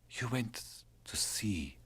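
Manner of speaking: slow, hushed whisper; whispered question. Acted. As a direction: ask gently, barely voiced. Drenka asks softly.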